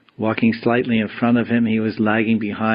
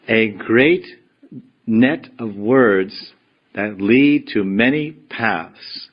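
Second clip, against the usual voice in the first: slow, weighty reading of a holy verse; slow verse recitation; much slower than usual. Acted. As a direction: read each phrase slowly, letting it sink in.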